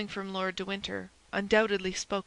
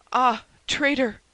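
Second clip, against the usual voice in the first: slow, loud, higher-pitched accusation; anguished cry; 4 semitones higher, 7 dB louder, slower than usual. A narrator's reading. Stabbed Buckingham to Felton, drawn-out anguished cry. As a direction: cry out on being betrayed. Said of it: "cried Buckingham".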